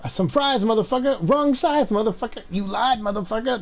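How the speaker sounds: high-pitched hammed-up punchlines; mock-triumphant, pitched well up; meme catchphrase riff. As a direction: fire off each parody like a surprise reveal.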